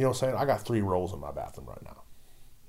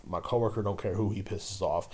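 usual speaking voice

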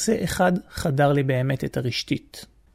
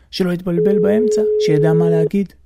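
usual speaking voice

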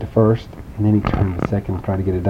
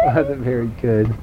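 usual speaking voice

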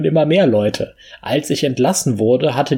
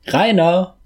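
calling out; 8 semitones higher than usual. A narrator's reading. Father calling from the forest.

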